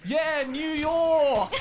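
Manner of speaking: big high slow laughing yell of New York, Australian vowels; Aussie New York accent. Retold or imitated. Recalling his final take as a New York mayor, Aussie accent. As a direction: ham up a fake New Yorker with an Aussie twang. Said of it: "the most Australian sounding New York".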